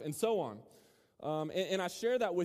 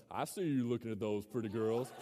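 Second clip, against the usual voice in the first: Bubba; 7 semitones lower than usual. Retold or imitated. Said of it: "And he goes".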